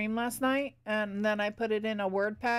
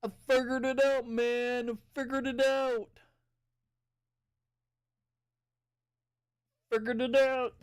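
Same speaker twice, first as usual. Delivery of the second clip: raised-pitch celebration; playful triumph; 3 semitones higher than usual. Real, not acted. Streamer pleased the check-in worked, playful triumph. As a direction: crow about it, playfully.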